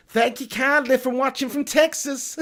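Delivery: high, slow Southern belle Texan drawl; Texan accent. Shown as a demonstration. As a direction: have a hammed-up go at a Texan accent. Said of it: "do a Texan accent, actually, but I'll give it a go".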